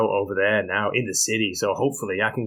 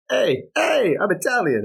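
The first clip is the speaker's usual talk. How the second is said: high mock Italian bravado; put-on high, cheesy "hey, hey"; clunky Italian catchphrase; 9 semitones higher, slower than usual. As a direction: ham up the corny Italian line. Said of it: "apart from the clunky".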